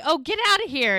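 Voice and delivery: loud, high mock dismissal; shooing him away. Bride sending the groom away from friends, playful shooing. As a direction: act out shooing someone off. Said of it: "she's, like, shooing him away".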